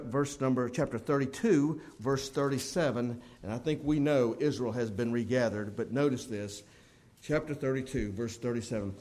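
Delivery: ordinary delivery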